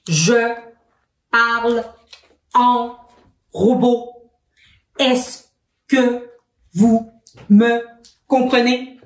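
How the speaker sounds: staccato, a pause after every word; each word clipped apart like a robot. Shown as a demonstration. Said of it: "Qu'est-ce que j'ai fait en parlant en robot?". (voix de robot, mot par mot, en détachant chaque mot)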